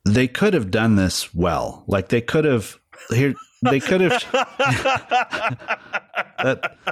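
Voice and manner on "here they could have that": high squeal of laughter; laughing. Real, not acted. Laughing at the idea.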